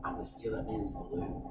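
very low, hushed murmur; confiding aside. Retold or imitated. Prankster confiding his plan to companion, low secretive murmur. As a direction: lean in and confide it under your breath. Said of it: "I lean over to her".

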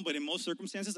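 ordinary talk, nothing marked

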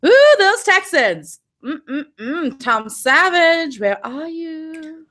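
mock swooning. Mock longing for player.

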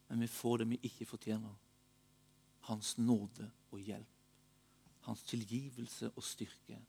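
low, very soft, slowing to a hush; tender assurance. Real, not acted. Gentle assurance of undeserved grace.

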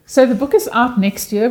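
amused, laugh spilling into the line. Real, not acted. Laughing about the book question.

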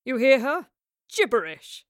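loud, dismissive scoff; contemptuous outburst. A narrator's reading. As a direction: scoff it out scornfully. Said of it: "Rivers scoffed".

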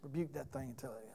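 whispered rote prayer, under the breath; a throwaway whispered prayer; mock mumbled whisper.

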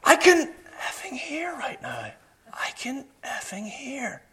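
high, hushed mock scream; strained whisper standing in for a scream; retold scream. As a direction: imitate screaming in amazement, volume held down. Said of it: "he's screaming at the top of his voice".

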